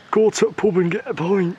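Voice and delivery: playful northern bloke impression; cheeky lad voice for the boy's first pint; mock northern accent. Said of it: "Do that again".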